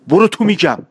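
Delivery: put-on boy scolding the girl to go inside; sharp rebuke. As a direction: snap the order at her. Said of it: "با تشر گفت".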